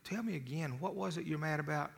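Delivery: soft, held-down volume. Retold or imitated. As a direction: drop your voice and keep it soft. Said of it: "and I lower my voice".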